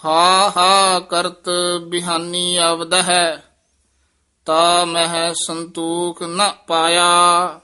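slow, measured scripture recital; Gurbani recitation. Acted. Teacher reciting Gurbani to the student, slow and measured. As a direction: recite each word clearly for the student.

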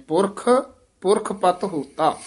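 first Purakh set apart by a brief pause; short break. Shown as a demonstration. Teacher showing the yamak, pausing after first Purakh. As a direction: lift Purakh, pause, then ਪੁਰਖ ਪਤ ਹੋਤਾ. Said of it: "ਯਮਕ ਲਾ ਦੇਣੀ ਹੈ".

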